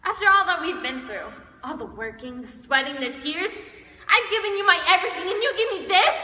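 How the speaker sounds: ordinary delivery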